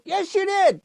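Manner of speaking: high-pitched, insistent comeback; childish high-pitched contradiction; squabbling retort. Acted. Back-and-forth squabble with the puppet.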